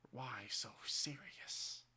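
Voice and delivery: slow quiet menacing line. Acted. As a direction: breathe it slowly, creeping. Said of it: "My father sees me".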